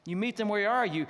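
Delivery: ordinary talk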